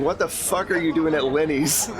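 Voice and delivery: usual speaking voice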